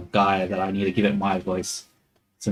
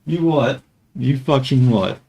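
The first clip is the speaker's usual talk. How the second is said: loud joking disbelief, higher; mock outrage; 4 semitones higher, 4 dB louder than usual. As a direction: pretend to be insulted.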